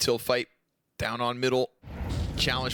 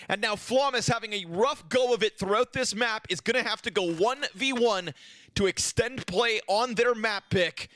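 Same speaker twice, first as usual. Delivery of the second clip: raised, driving esports commentary; excited play-by-play; 6 semitones higher than usual. Acted. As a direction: build the round to its final call. Live play-by-play to match end.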